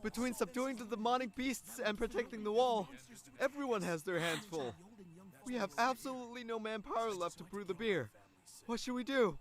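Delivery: high-pitched worried report; fretful. Acted. Servant reporting a crisis to the king, fretful. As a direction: plead helplessly, pitched well up.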